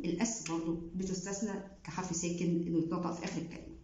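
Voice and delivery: ordinary delivery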